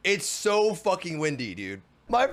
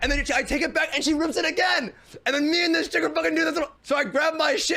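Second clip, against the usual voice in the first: heated retelling; 7 semitones higher than usual. Real, not acted. Telling the audience about the tussle, excited. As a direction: race through the climax, worked up.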